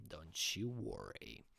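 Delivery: ordinary talk, nothing marked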